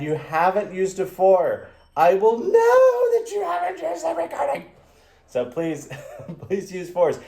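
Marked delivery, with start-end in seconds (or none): 2.0-4.7 very high, squealed mock threat; exaggerated squeaky warning; mock scolding. 5.4-7.2 chuckling through the request; amused, giggly "please"; laughing plea. Real, not acted. Laughing after the mock outburst.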